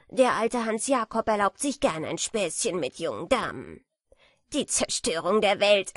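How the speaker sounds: a smug know-it-all beetle teasing; lightly voiced boastful beetle; proud beetle. A narrator's reading. Beetle Hans Jakob lectures.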